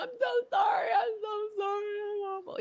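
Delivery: high, wailing sobbed apology; mock crying apology. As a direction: sob it out, over the top. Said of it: "snotting and crying".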